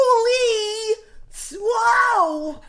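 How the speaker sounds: high, loud cries of amazement; mimicked crowd freak-out. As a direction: imitate a stunned crowd going wild. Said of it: "freaking out".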